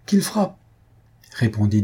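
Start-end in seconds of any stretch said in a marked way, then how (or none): 0.1-0.6 very high, ringing defiance; defiant cry. Acted. Young man shields the old priest, crying out in defiance. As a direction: throw the dare at them, pitch soaring.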